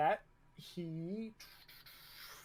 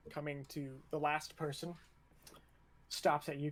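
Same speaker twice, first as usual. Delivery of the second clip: ordinary talk, nothing marked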